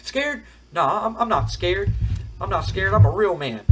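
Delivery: high-pitched, mock-offended protest; indignant denial. Wrestler denies taunt of being scared.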